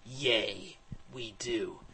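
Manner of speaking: soft, cool reply; coolly. A narrator's reading. Hero agreeing with his dragon about the mission, coolly. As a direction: answer quietly and unbothered. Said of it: "I said coolly".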